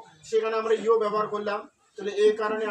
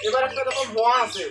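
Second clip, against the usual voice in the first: usual speaking voice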